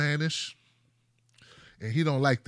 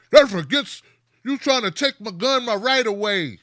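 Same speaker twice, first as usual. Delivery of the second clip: mock gun owner; 5 semitones higher, 7 dB louder than usual. Mocking gun owners' protest.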